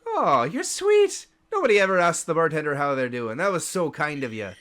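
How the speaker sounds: high, rapid overly sweet chatter; flustered bartender. Acted. Dazed bartender chatting to the elf, overly sweet and rapid. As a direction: babble cheerfully to hide confusion.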